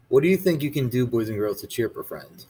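plain reading voice

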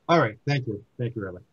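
normal talking voice

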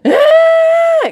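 a high, loud child's wail imitated; toddler wail. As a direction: mimic a toddler bursting into a wail. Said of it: "starts going".

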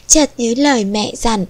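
usual speaking voice

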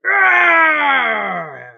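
huge high roar, tailing downward; full-force pretend lion. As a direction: let out a long, very loud playful lion roar. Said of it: "let me roar".

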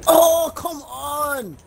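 drawn-out despairing groan; anguished wail. Real, not acted. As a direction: wail it slowly in frustration. Exasperated loss in game.

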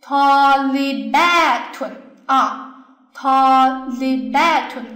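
Talibatun sounded out very slowly, long a vowels held; teacher's drawn-out model reading of Talibatun; slow word demonstration. Shown as a demonstration. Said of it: "读出来就是".

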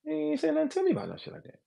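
mock disbelief, voice pushed up high; incredulous retold reaction. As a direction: play the confused rapper asking what he's talking about. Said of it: "And you say".

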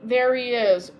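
usual speaking voice

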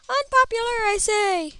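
high, loud theatrical declaration; mock proclamation. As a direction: cry it out like a hammy orator. Repeats it as a proclamation.

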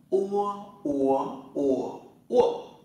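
"Ua" gliding u into a rounded vowel, through four tones; o read as "ua". Shown as a demonstration. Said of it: "sẽ đọc là ua". (đọc "ua" qua bốn thanh điệu)

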